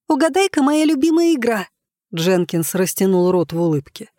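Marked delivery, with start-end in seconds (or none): 0.1-1.7 put-on high girlish squeal. A narrator's reading. Giggly girl squealing her guess at Jenkins, high-pitched. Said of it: "взвизгнула смешливая девочка".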